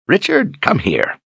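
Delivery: put-on high old man calling out; high call, then a lighter old voice; old wizard calling. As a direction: summon the boy briskly. Zed calls Richard.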